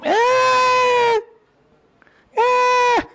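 very high piercing newborn wail; imitated baby cry. The newborn cries after the doctor pinches him, high wail.

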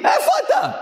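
worried wife shouting for her husband, high-pitched. Retold or imitated.

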